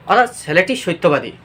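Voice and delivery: ordinary delivery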